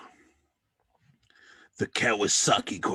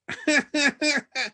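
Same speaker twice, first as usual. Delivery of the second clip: high cartoon cackle; squeaky cackle; 5 dB louder than usual. Thug guard cackling at the stranger's answer.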